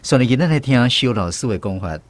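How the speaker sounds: ordinary delivery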